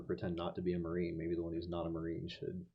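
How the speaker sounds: usual speaking voice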